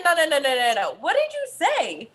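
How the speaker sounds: mock stern mother. Mother retells grilling her son, mock-stern and insistent. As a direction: cut the kid off and press him, pitch swinging up.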